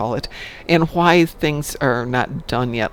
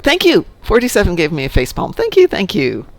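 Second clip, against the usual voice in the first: mock gratitude; 5 semitones higher, 6 dB louder than usual. Real, not acted. Mock bows after joke.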